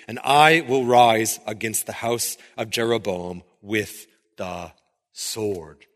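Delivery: measured, solemn Bible reading; low, unhurried reading of the prophet; scripture reading. Acted. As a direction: read the passage as holy text. Reads Amos aloud.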